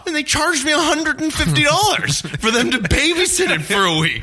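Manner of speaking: can't hold back laughter at the absurd charge; real laughter while telling the fee; laughing. Real, not acted.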